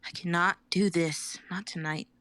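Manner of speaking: ordinary talk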